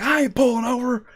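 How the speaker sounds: mock shouting comeback; defiant driver. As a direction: play the stubborn driver yelling back. Voicing defiant other driver.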